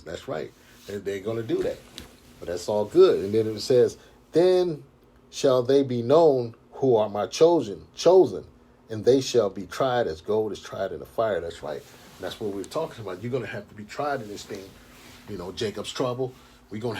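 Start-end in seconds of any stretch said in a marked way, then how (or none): 4.3-11.4 slow, measured preaching read; scripture reading. Acted. Street preacher reads prophecy to his brothers, slow and solemn.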